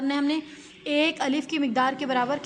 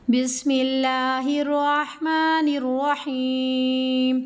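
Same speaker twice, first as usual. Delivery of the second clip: slow, drawn-out tajweed recitation; Quranic recitation; 4 dB louder, much slower than usual. Shown as a demonstration. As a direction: chant it slowly like Quran recitation. (धीमी तजवीद वाली तिलावत)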